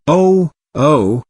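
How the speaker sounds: shrill terrified cry. Acted. Lost boy in the snowstorm cries out to Ruth, mildly frightened. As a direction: gasp out a thin, scared "oh, oh". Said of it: "cried a shrill, terrified voice".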